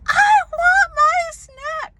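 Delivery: high, drawn-out loud yell; screaming tantrum. Retold or imitated. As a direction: imitate a screaming child making a demand. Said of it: "she starts screaming".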